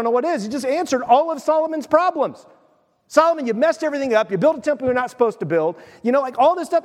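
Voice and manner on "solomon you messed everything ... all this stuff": higher, fast playful scolding of Solomon; quick mock God rebuke. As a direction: rattle off God's complaint.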